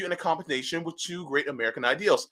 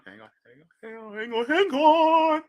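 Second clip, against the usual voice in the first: building excitement; 9 semitones higher than usual. Real, not acted. Rising hype while loading.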